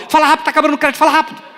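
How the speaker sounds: loud, high-pitched rush; urgent hurrying. Retold or imitated. Payphone caller with credit running out, urgently hurrying. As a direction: hurry them along before time runs out. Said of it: "quando tava acabando o crédito, você falava".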